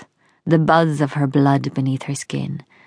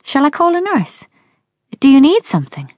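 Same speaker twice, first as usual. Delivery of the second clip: much higher, anxious questions to her father; alarmed; 10 semitones higher, 5 dB louder, faster than usual. Acted. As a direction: daughter frightened for her sick dad, voice rising. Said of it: "Lara looks alarmed".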